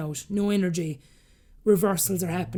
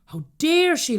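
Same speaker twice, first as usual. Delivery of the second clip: higher, louder affronted outburst; retold indignation; 9 semitones higher, 5 dB louder, slower than usual. Recalling reaction to tearful sister, indignant. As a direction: act out being offended by her nerve.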